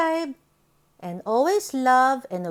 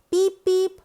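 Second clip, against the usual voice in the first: high, louder beep beep like a toy horn; 6 semitones higher, 3 dB louder than usual. Acted. Storyteller sounding the little truck's horn. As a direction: honk it out playfully.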